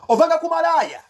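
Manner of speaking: loud, high accusing outburst; angry scolding. Real, not acted. Angry rant insulting a rival.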